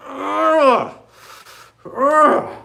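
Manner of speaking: high, loud fed-up ugh; exasperated groan. Retold or imitated. To viewers, about the stress of loans, fed-up groan. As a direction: groan out the frustration.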